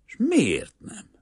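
high, slow, strained question; stunned demand. A narrator's reading. Banker staring at the peasant after refusal, stunned and demanding. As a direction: ask it slowly in disbelief.

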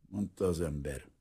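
usual speaking voice

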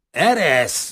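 loud, high, rough dismissal; gruff one-word order; rude snap. A narrator's reading. Kazimír to Rozita tugging his coat, rudely. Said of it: "mordult rá Gorombán".

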